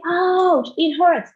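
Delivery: a small playful ouch; mock ouch. Shown as a demonstration. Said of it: "and I say".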